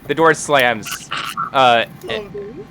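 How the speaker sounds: usual speaking voice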